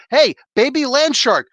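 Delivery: ordinary talk, nothing marked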